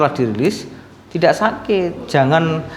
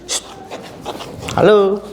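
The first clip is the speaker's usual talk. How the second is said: usual speaking voice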